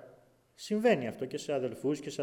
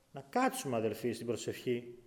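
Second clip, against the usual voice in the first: ordinary delivery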